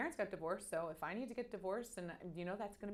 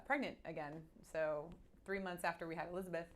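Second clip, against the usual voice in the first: ordinary delivery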